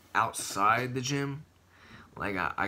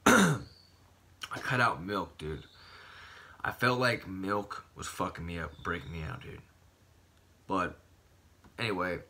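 ordinary talk

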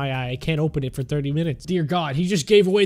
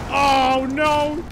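alarmed cry; 9 semitones higher than usual. Real, not acted. Monster attacks.

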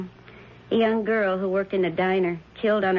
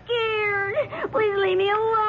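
high, crying plea for mercy; terrified pleading; slower than usual. Acted. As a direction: beg through tears, terrified. Victim begging her attacker.

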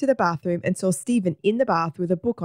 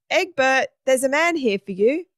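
raised, higher call through the house; 7 semitones higher, 4 dB louder than usual. A narrator's reading. Margaret to Egbert about a visitor, calling out. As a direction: call someone to the front door.

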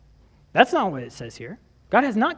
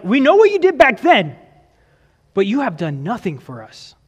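accusing God; 3 semitones higher, 5 dB louder than usual. Retold or imitated. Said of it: "You can hear it right away".

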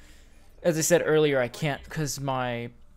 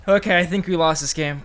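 loud; 4 semitones higher, 11 dB louder than usual. Real, not acted. Frustrated gamer losing match.

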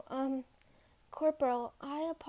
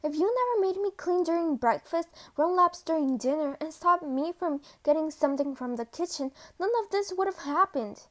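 loud, raised-pitch tirade; frustrated yelling; 2 semitones higher, 6 dB louder than usual. Acted. Cadet yelling at the superior, frustrated tirade. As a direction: lash out, punching out each grievance. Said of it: "You lashed out of frustration".

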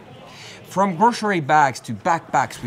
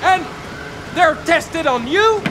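excited; 11 semitones higher than usual. Acted. Hyped product pitch building up.

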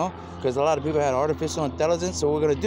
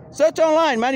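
louder and higher, pressing the point; urgent call; 11 semitones higher, 5 dB louder than usual. Real, not acted. Telling listeners to find the snake video, loud urging. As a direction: push them to go look it up.

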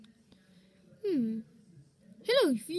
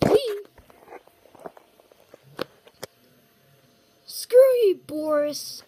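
gleeful taunt; 3 semitones higher, 7 dB louder, slower than usual. Acted. Character jeering loudly at Boris. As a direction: crow it at him.

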